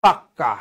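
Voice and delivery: পাক্কা with a held, doubled k; pak-ka for পাক্কা; doubled consonant. Shown as a demonstration. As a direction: stop on the k of পাক্কা, then release. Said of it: "পাকা হয়ে যাবে কি?".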